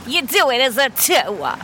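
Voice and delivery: tour said "tuə" New York style; Brooklyn accent. Pretend. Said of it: "Not tour like in a Brooklyn accent".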